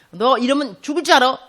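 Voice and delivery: loud stern threat; mock-threatening and loud; harsh warning. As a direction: warn forcefully, as God laying down the law. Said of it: "말을 세게 하는 거예요".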